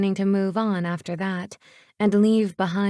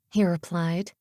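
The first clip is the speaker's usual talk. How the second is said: ordinary delivery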